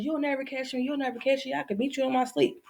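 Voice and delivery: high, sing-song boast; taunting hare. Acted. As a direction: tease them as a cocky racing hare. Hare taunts tortoise while racing.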